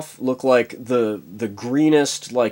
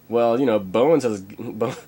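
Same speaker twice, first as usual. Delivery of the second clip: normal talking voice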